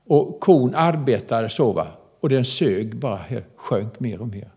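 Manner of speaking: usual speaking voice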